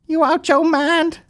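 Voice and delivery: high, slow squealing disbelief; high slow mock disbelief; mock shrill disbelief. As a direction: squeal it out slowly in mock shock.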